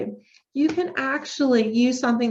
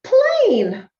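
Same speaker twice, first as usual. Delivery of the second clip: put-on child answering; 11 semitones higher, 6 dB louder than usual. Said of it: "And they could say".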